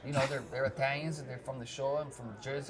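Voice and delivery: put-on New York Italian accent. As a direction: talk like an Italian family from New York and Jersey. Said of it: "and they talk like this".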